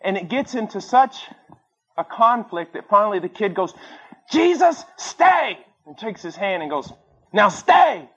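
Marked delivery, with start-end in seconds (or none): 4.3-5.6 sharp high yell of Jesus, stay!; shouted command. Retold or imitated. Kid in the play shouts at Jesus, loud high command. 7.3-8.1 loud clipped command; firm order. Retold or imitated. Kid in the play tells Jesus, loud firm order.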